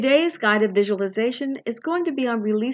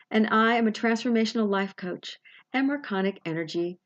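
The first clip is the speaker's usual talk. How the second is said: ordinary talk, nothing marked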